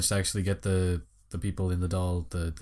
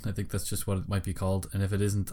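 ordinary talk, nothing marked

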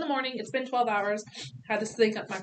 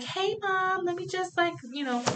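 high, sing-song nurse voice; mock-sweet nurse, pitched up; 10 semitones higher than usual. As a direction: play the overly chirpy nurse. Nurse voiced in birth story.

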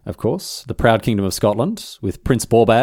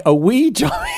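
incredulous echo; 13 semitones higher than usual. Retold or imitated. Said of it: "I'm like".

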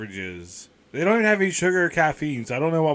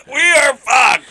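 mock despair shout; 7 semitones higher, 6 dB louder, much slower than usual. Real, not acted.

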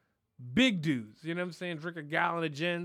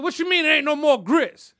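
loud, high outraged question; husband yelling at his wife about grits; grandpa outraged; 9 semitones higher, 13 dB louder than usual. Retold or imitated. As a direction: demand an answer, furious. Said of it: "He said".

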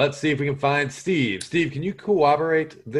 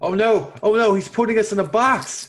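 mock panic; 7 semitones higher, 4 dB louder than usual. Acted panic at mime.

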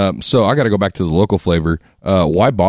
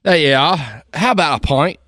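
mock British; 7 semitones higher than usual. Trading mock British pub lines.

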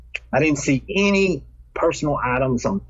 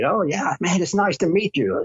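higher, eager greeting; excited; 6 semitones higher than usual. Retold or imitated. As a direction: gush like meeting a hero. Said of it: "he starts getting all excited".

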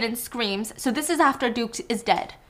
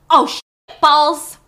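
louder, slow, stinging outburst; mock pained yelp; 5 semitones higher, 10 dB louder, slower than usual. Blanc with hot sauce burning his eyes, cursing in loud pain. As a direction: curse through burning eyes. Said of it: "but it's burning".

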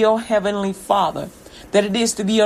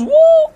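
high, loud whoop of temptation; tempter's woo; 6 dB louder than usual. Acted. Pied Piper tempting the listener with a loud whoop. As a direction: whoop like a flashy street hustler. Tempter flaunting offered goods.

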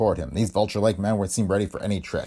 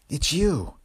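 high, breathless voice on the phone; 7 semitones higher than usual. Acted. Freed captive answering the agent's call, breathless. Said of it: "came the breathless answer".

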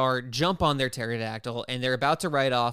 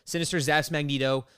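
ordinary talk

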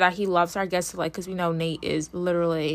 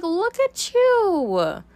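high, very slow mocking praise; sarcastic slow-clap tone; 11 semitones higher, much slower than usual. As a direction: drag it out with teasing sarcasm.

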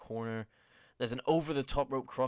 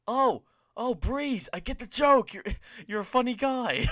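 high, very loud mock outburst; Fandango; 13 semitones higher, 12 dB louder than usual. Host mimics Fandango finally getting the joke, loud and high. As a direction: cry it out as a sudden exaggerated realisation. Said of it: "And then five seconds later, he says".

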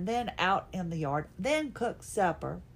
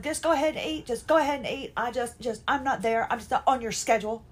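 re-enacted snippy brush-off, pitched up; pissy and snippety; 4 semitones higher, 3 dB louder, faster than usual. Wife refusing husband's schedule, acting out being pissy and snippety. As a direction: wave him off with high, exasperated insistence. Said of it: "That was me getting all pissy and snippety".

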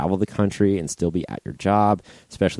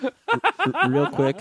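laughing; 7 semitones higher, much slower than usual. Real, not acted. Laughing while starting.

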